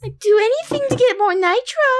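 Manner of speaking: put-on high cutesy e-girl voice; begging Discord kitten. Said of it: "Discord kitten voice".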